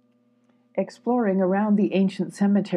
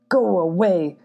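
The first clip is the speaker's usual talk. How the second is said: gruff growl, louder; a badger growling at an intruder; 6 dB louder than usual. A narrator's reading. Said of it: "growled the badger".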